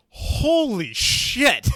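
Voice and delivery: loud, drawn-out awed exclamation; mock-amazed outburst, very slow; retold amazement. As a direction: stretch it out high and loud, imitating a stunned onlooker. Kid amazed at the rifle.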